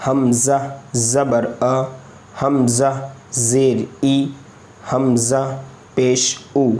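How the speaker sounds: slow letter-by-letter spelling, each vowel named; spelled out slowly. Shown as a demonstration. Teacher spells hamza with its vowels for pupils, slowly. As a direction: drag out each syllable for the pupils. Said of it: "ये हिज्जे से पढ़ना कहलाया है".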